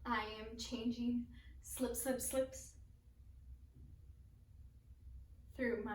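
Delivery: halting, hushed verse with long pauses; poem recital. Acted. Performer recites a poem of a shattered dream, halting poem recital.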